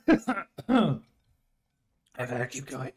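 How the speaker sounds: coughing through the spice burn; mouth burning from spicy food. Real, not acted. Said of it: "I can't get this with chopsticks".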